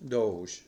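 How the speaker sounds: Doge as one syllable with a long O; popular pronunciation. Shown as a demonstration. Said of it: "the most popular way of pronouncing it".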